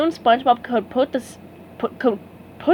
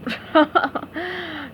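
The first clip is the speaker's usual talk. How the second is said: chuckle; 5 semitones higher than usual. A narrator's reading.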